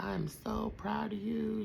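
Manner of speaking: lightly voiced proud auntie; imitating proud auntie. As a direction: gently echo the auntie's pride. Retelling auntie's proud words.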